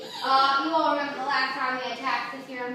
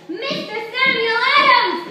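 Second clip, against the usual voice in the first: louder, slower announcer call; grand announcement; 8 semitones higher, 9 dB louder, slower than usual. Acted. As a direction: present him like a hero. Host announces guest speaker.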